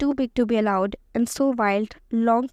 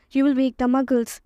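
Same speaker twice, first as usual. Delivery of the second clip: usual speaking voice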